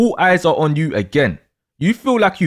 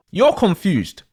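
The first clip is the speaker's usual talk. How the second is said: ordinary delivery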